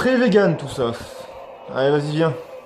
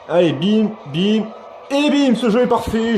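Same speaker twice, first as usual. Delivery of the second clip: excited; 8 semitones higher, 3 dB louder than usual. Real, not acted. Excited cheering hits.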